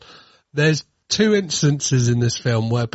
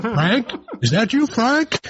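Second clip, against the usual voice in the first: mock phone voice; 9 semitones higher than usual. Mock imitating film character line.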